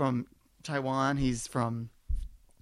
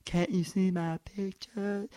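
slow, dopey mock voice; imitating him; 3 semitones higher, 5 dB quieter, slower than usual. Comedian shows how the guy actually talks, slow mock. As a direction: drag the question out simple. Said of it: "I know how he talks".

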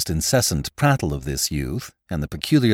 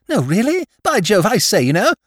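much higher and louder, silly-ass exclamation; astonished young lord; 12 semitones higher, 5 dB louder than usual. Acted. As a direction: squeal it like a dim toff amazed. Imagined reaction to the secret.

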